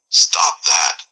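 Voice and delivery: hissed stop that; curt whispered order; irritated. Acted. Brain-fried man ordering in a hiss. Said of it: "on the verge of shutting down".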